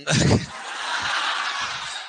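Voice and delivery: soft airy laugh; breathy laugh. Real, not acted. Teacher laughs at his own remark, soft airy laugh.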